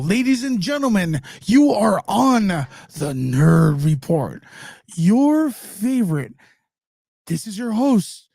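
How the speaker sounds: drawn-out host hyping his own show; radio promo voice. Shown as a demonstration. Streamer asked for a Nerd Report promo, slow booming announcer read. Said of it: "How would a promo for Nerd Report go".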